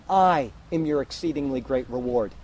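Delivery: "I" stretched and set apart from what follows; stress on I. Shown as a demonstration. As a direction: lean on "I", then let the rest fall. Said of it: "the I is emphatic".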